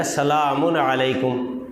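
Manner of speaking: سلام علیکم slowly, sounding "salaamun alaikum"; better greeting. Shown as a demonstration. Preacher models the better form, سلام علیکم slow and full. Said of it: "اس سے زیادہ بہتر ہے".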